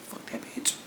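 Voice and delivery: barely audible whisper; voiceless, under-the-breath aside. Retold or imitated. Friend muttering behind her back.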